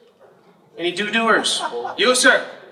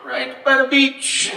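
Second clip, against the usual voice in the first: slow and drawn out, higher; slow deadpan; 6 semitones higher, much slower than usual. Retold or imitated. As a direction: lay it out slow like it's obvious. Customer's absurd claim.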